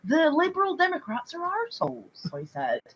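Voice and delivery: put-on lightly done Bristol accent, chatty and offhand; a hint of a Bristol man casually confessing; Bristol man character. [name] joining in the Bristol killer character for Mike. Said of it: "getting dangerously close to Stephen Merchant".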